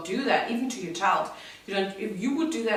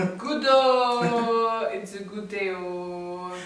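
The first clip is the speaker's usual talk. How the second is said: Nigerian "oh"; 4 dB louder, slower than usual. Shown as a demonstration. German partner showing how Nigerians add oh, playful Nigerian lilt. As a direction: stretch each "oh" in playful Nigerian style. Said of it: "how in Nigeria we add oh".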